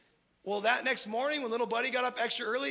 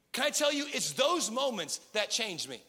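ordinary talk, nothing marked

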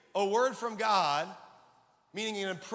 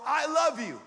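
loud, higher-pitched declaration; emphatic raised voice, lightly shouted; imitated shout; 5 semitones higher, 6 dB louder than usual. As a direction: call it out as a divine assurance. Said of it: "Holy Spirit shouted into me".

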